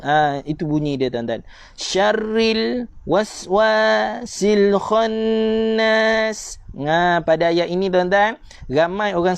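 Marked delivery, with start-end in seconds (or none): 1.8-6.6 very slow chanted tajwid recitation; Quran recitation. Shown as a demonstration. Teacher reciting the verse for students, slow and melodic. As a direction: draw every vowel out like a qari.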